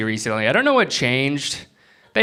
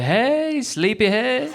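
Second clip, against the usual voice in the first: high, singsong wake-up call; playful high sleepyhead coo; sweet wake-up voice; 11 semitones higher, much slower than usual. Pretend. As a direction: coo it like waking a child. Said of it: "I can't be like".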